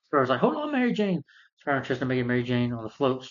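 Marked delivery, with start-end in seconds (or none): 0.4-1.2 high, urgent mock call; heroic call. Spider-Man calling to Mary Jane on the floats, urgent. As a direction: imitate a hero calling up to someone.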